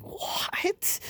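breathless whispered disbelief; shocked whisper. Retold or imitated. Viewer stunned by the scene, a shocked whisper. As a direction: whisper it like you can't believe your eyes. Retelling own shocked reaction.

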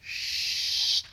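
long unvoiced shushing hiss; imitated page noise. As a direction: mimic stuck Bible pages with a long "shh". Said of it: "you hear this".

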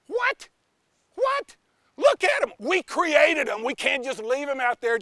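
can't believe what they're hearing; incredulous protest. Acted. Love and mercy protesting to justice, high and incredulous. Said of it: "Love and mercy are sitting over here going".

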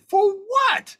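high, loud disbelieving question; incredulous shout. Retold or imitated. Hearing the studio sent his script to Hanks, shouting back in disbelief.

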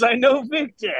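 high mock boast; guy's voice. A man name-drops Victor to the owner, high mock boast. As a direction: brag it like a guy name-dropping. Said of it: "He goes".

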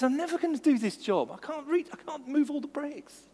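put-on a small boy giving up, close to crying; upset little boy. Preacher voicing a hurt small boy who gives up. Distressed boy voiced in story.

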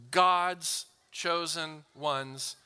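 "God's chosen ones" stretched very slowly, each word separate; slow, savoring. Shown as a demonstration. Preacher dwelling on the phrase for his listeners. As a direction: linger on every word. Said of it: "read these words slow".

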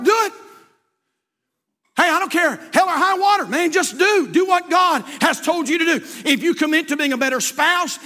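loud, high preacher building to a shout; preaching at full force. Acted. Pastor to congregation, fired-up preaching shout. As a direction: rouse the church with a shouted climax.

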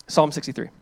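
usual speaking voice

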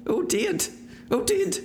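very high put-on voice of the dad; impression of the dad. The dad's voice Brent wants to hear from [name]. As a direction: squeak it out like the dad. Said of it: "all I wanna hear from you now is the".

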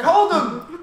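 high, loud, laughing yell of "Hold him!"; Superbad quote. Quoting Superbad to friends, high loud laughing yell. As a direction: yell it like the movie scene, cracking up. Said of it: "that scene in Superbad".

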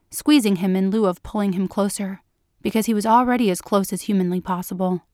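ordinary delivery, nothing marked

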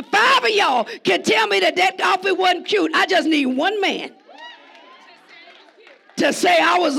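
full-force sermon crescendo; fervent preaching. Real, not acted. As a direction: drive it home to the congregation.

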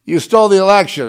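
shouted high and loud accusation; retold yell. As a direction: yell it at the president across the room. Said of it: "yell at Biden".

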